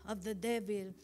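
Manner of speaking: fervent sermon, pressing each phrase; preaching. Acted. Pastor exhorting the congregation, fervent preaching. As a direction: preach it to the church with conviction.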